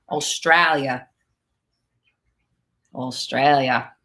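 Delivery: put-on low, drawn-out Aussie accent; Australian accent. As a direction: play with a slow, deep Australian accent. Playfully repeats the country in accent.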